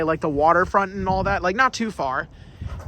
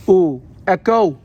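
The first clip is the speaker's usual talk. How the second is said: echoing in the empty shaft; loud call ringing back off the walls; elevator shaft; 7 dB louder, slower than usual. Real, not acted. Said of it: "you hear my echo in the shaft".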